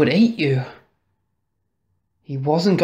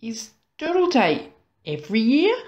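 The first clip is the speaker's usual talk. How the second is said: put-on higher-pitched child's question with rising end; curious child asking; 7 semitones higher, faster than usual. A narrator's reading. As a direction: ask it high and wondering, as Harvey. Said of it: "asked Harvey".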